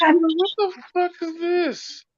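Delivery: high, slow, stretched-out question; acted bewilderment. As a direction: draw it out in high mock disbelief. Acting out bewilderment at the cop.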